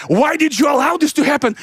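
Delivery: imitated high-pitched reproach; scolding pastor. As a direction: demand an explanation as an outraged pastor. Pastor scolding the cell leader.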